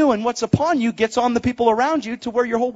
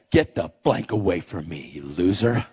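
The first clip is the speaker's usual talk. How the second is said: very low, softer stepdad voice; imitated scornful dismissal; 5 dB quieter than usual. Stepdad rebuffing the groom's love, scornful. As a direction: send him away in a low, cold mock growl.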